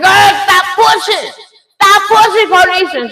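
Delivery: high, loud mock command; loud, high-pitched retold scolding; acted urgent yell. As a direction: re-enact hollering at your daughter to stop. Mother yelling at labouring daughter.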